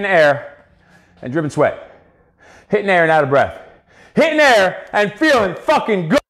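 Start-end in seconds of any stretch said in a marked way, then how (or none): 4.1-6.2 high, drawn-out emphatic hype; hyping up. Real, not acted. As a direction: stretch each word to pump them up. Coach hyping up viewers.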